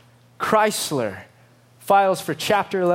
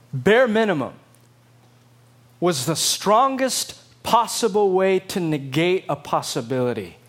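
ordinary talk, nothing marked

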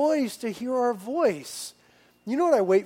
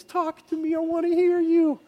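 affectionate father; 9 semitones higher than usual. Retold or imitated. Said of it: "I'm grabbing his face".

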